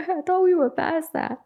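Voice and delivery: high-pitched mock disbelief; high mock echo. Shown as a demonstration. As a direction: say it again way up high, incredulous.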